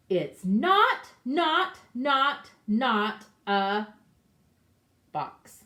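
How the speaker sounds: loud hammering repeated nots; mad rabbit. Acted. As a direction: rabbit furious, stamping each not. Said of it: "He's kind of mad".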